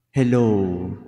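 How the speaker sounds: ordinary talk, nothing marked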